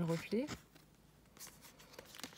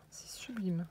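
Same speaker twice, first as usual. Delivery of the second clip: whisper; 5 dB quieter, slower than usual. Real, not acted. Admiring a craft detail aloud.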